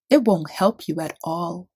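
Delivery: plain reading voice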